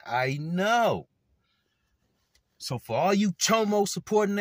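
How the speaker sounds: loud, high furious callout; angry rant. Real, not acted. Calling out abuse supporters in a loud furious rant. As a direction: shout them down, voice climbing.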